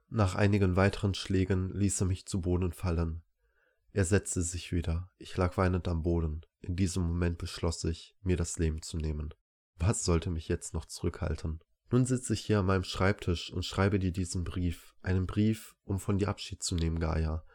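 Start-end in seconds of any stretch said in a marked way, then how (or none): none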